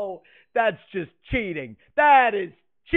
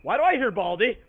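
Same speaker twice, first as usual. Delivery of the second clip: ordinary talk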